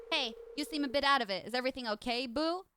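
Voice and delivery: ordinary delivery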